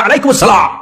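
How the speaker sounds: high, curt mock-angry greeting back; imitating a sharp-tongued woman's annoyed reply; harsh, irritated voice. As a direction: return the salaam crossly, pitched high. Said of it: "কর্কশ কণ্ঠে, ঝাঁঝালো কণ্ঠে, রাগ মাখা কণ্ঠে".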